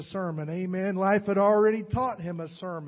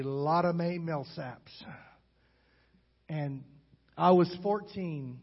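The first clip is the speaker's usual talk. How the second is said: usual speaking voice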